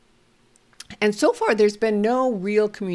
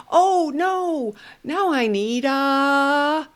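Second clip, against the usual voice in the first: high, slowed mock dismay; playful surprise; 7 semitones higher, much slower than usual. Shown as a demonstration. Therapist pretending to miss a piece, high mock dismay. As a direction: act puzzled for the child. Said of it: "playing with toys".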